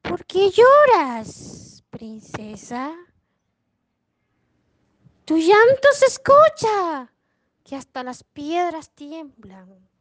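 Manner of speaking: slow, swooping voice out of nowhere; drawn-out storybook frog voice; mysterious frog voice. Acted. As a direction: call out slowly, high and loud, then drop away. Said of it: "escuchó una voz".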